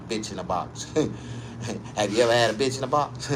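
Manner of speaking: ordinary delivery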